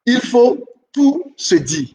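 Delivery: il faut tout se dire spelled out slowly, leaning on tout; slow emphatic rule. Shown as a demonstration. Teacher to listeners, slow emphatic rule. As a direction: lay it down word by word.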